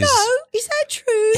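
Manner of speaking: hammed-up British-style accent; put-on accent. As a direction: question it in a high comic accent. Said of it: "putting too much variance in the accent".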